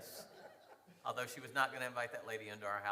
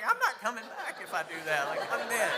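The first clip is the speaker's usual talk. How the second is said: higher and louder, laughing protest; mock alarmed protest; 9 semitones higher, 8 dB louder than usual. Husband laughingly refusing wife's plan to drive the strangers. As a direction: protest like you'd never come back alive. Retold argument with wife, fearful.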